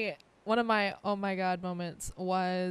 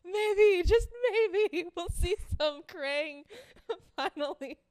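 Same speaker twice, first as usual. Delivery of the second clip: gleeful; 9 semitones higher than usual. Real, not acted. Gleeful hope for Krang deaths.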